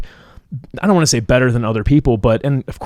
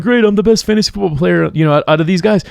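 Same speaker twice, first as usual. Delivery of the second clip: much higher, hammed-up smug brag; mock boast; 8 semitones higher, 3 dB louder than usual. Fantasy football winner mocking his own bragging, higher and smug. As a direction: crow about it like a proud champion. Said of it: "and I'm like".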